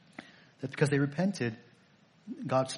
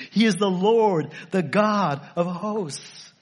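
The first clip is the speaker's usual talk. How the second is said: slow, loud proclamation; preaching climax; 5 semitones higher, 6 dB louder, slower than usual. Real, not acted. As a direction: proclaim it slowly and broadly.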